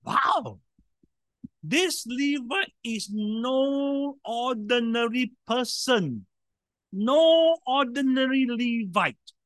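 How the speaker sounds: ordinary talk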